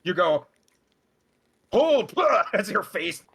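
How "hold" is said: barked spell command; commanding shout. Acted.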